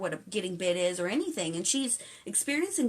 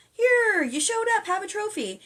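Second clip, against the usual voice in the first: high, loud, mock-cheerful handing out a prize; mock an overly cheerful coach; mock trophy giver; 12 semitones higher, 6 dB louder than usual. Mocking adults who hand every kid a trophy, high and sing-song.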